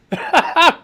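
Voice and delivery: a real laugh bursting through, high and loud; laughing in spite of herself. Real, not acted. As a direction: laugh breaks out mid-word. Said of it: "don't wanna laugh".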